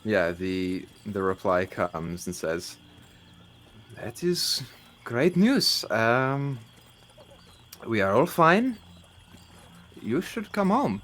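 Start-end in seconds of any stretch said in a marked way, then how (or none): 4.0-11.0 bad French accent. GM voicing Lou's second reply, rough French accent, much higher. GM voices Lou replying again.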